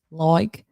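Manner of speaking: like said as loik, Australian vowel; like stretched into loik, Aussie style. Shown as a demonstration. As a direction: say like with an oi sound, loik. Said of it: "L O I K E".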